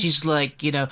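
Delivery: ordinary talk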